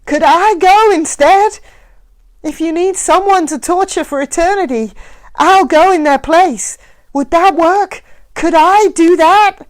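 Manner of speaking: high, loud desperate pleading. Acted. Mac pleading to go instead of his children. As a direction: beg to take their place. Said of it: "pleading with his eyes".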